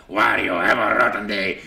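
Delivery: a sour greeting from the bad guy; grumpy Wario. Mornings he feels like Wario, a grumpy villain voice. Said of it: "I'm a lot more like".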